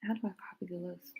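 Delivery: unvoiced whisper to herself; whispered aside while hunting for the list. Real, not acted. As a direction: murmur it under the breath. Whispering to herself mid-stream.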